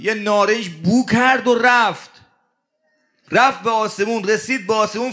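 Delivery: normal talking voice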